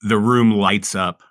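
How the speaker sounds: usual speaking voice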